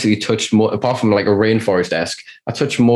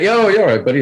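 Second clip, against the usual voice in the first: high, bright-pitched mock greeting of a stoner clerk; imitating shop guy; 11 semitones higher than usual. Shop clerk greets the British customer, high mock welcome. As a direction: toss off a jaunty yo, buddy. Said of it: "they're like".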